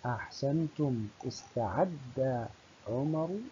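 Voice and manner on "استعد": استعد stretched very slowly, isteʕedda; slow, drawn-out demo. Shown as a demonstration. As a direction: spell out استعد syllable by syllable. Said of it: "الفعل استعد".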